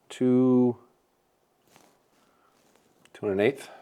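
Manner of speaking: ordinary delivery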